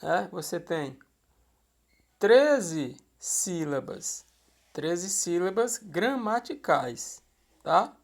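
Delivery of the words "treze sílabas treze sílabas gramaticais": drawn out, each word laid down slowly; slow teaching emphasis. Shown as a demonstration. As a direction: spell it out slowly for the class. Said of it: "counting syllables for students".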